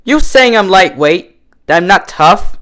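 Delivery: high, loud, slow affront; indignant challenge. A narrator's reading. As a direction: bristle at the insult. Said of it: "Rainbow Dash glared".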